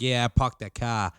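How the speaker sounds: put-on a friend's rough try at a Staten Island accent; imitating a Staten Island accent. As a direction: take a clumsy stab at a Staten Island accent. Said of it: "try to do my best".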